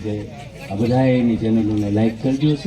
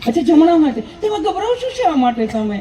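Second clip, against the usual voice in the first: high, sweet nurse voice, much higher; nurse's voice. Retold or imitated. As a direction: mimic the nurse asking politely. (ઊંચા મીઠા અવાજે નર્સની નકલ)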